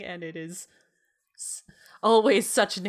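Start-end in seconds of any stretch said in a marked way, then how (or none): 1.4-1.6 hushed whisper. Real, not acted. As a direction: breathe it out as a whisper. Whispered aside.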